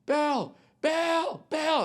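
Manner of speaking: high, sing-song calling of the name "Bill"; mock fan calling out. Comedian imitating a fan calling to him in a high voice. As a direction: squeal for his attention like an eager fan. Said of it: "This guy just goes".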